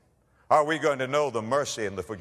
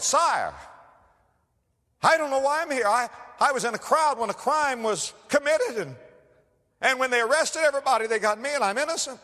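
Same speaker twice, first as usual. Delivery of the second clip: pleading prisoner; 5 dB louder than usual. Retold or imitated. Prisoner pleads innocence to king.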